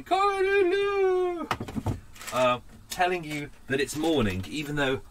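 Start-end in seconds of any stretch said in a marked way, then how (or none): 0.0-1.5 much higher, slow cockerel crow. Retold or imitated. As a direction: crow like a rooster at dawn. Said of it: "you have a cockerel going".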